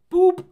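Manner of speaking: a sudden high, loud "boop" like a machine; high beep noise. Retold or imitated. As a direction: chirp it out like a projector switching on. Imitating projector beep.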